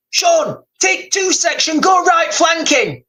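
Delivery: a corporal yelling orders across gunfire; shouted battlefield order. Retold or imitated. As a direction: bark the flanking order like a commander. Barked battlefield order, retold.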